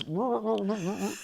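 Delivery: high muffled garble; imitated muffled noise. Player's friend hears only garble through the monster, high muffled noise. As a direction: mimic garbled cries, pitched well up. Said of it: "what they hear is".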